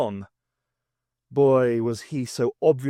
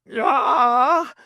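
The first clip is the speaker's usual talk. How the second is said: high, loud startled yell; startled shriek; 12 semitones higher, 7 dB louder than usual. Acted. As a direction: yelp in alarm. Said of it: "yelled Fitz".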